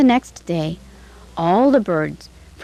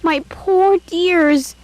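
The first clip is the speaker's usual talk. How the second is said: very slow, much higher, full of pity; pitying exclamation; 11 semitones higher, 3 dB louder, much slower than usual. A narrator's reading. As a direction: sigh over the caged birds. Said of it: "she exclaimed".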